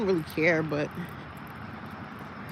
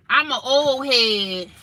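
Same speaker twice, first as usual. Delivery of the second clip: slow, stretched and a bit higher, louder; drawn-out old head voice; 4 semitones higher, 4 dB louder, much slower than usual. Shown as a demonstration. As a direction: stretch it out like a playful old-timer.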